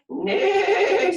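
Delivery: high, loud whinnying neigh; whinny it out like a horse; playful high horse neigh for kids. Acted. Horse's reply in the story.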